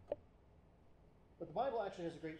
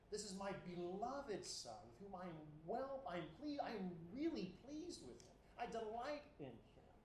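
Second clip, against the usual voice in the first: higher, beaming with fatherly pride; delighted voice; 4 semitones higher than usual. Acted. God speaking over Jesus, full of pleased delight. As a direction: say it like a proud father glowing over his son. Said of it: "God is expressing pleasure".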